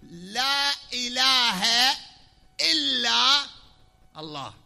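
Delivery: لا and إلا called out very slow, loud and high, الله dropping; stress on لا and إلا. Shown as a demonstration. Preacher demonstrating the exception formula, slow loud stress. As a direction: stretch out لا إله, then peak on إلا. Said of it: "نفي وشنو؟".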